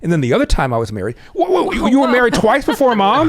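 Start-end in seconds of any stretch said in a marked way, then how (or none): 1.3-3.3 much higher, louder, an incredulous yelp; shocked son can't believe it, voice jumping way up; stunned disbelief. Retold or imitated. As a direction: son floored to learn dad married twice before, high disbelieving cry. Son stunned, married twice before.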